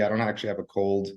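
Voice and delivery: normal talking voice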